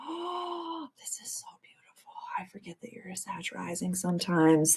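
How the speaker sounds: soft breathy whisper of wonder; awed whisper. Real, not acted. Reading a viewer's chart, in an awed whisper. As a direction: whisper it, as if she can't believe it.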